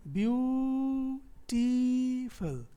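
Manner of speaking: ब्यूटीफुल stretched out as byuuu-teee-f'l; slow teaching breakdown of ब्यूटीफुल; syllable by syllable. Shown as a demonstration. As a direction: hold the ऊ and ई of ब्यूटीफुल long and high, short last syllable. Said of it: "दो syllable हैं".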